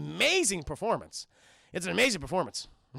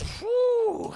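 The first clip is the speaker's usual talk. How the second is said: high-pitched exhaled whistle of amazement; high "whew"; 4 dB louder than usual. Retold or imitated. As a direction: let out a high mock whew. Tuco reacts to nine years.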